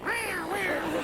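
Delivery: a laughing, high vocal siren wail; siren imitation. Host echoes the guest's siren noise, laughing. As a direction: copy the siren sound high, through a laugh. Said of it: "Was the policeman doing that?".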